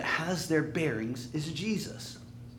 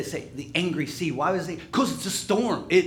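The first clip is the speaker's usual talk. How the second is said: usual speaking voice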